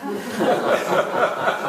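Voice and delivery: breathy high laugh. Real, not acted. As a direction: burst out laughing. Laughs at her own folly.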